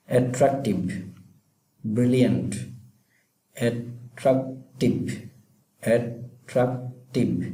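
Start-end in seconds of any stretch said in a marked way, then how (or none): 3.5-5.1 attractive split slowly into three syllables. Shown as a demonstration. Teacher showing students the syllables, attractive said in separate slow pieces. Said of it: "চার সিলেবলের এটা". 5.8-7.4 attractive split slowly into three syllables. Shown as a demonstration. Teacher showing students the syllables, attractive said in separate slow pieces. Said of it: "চার সিলেবলের এটা".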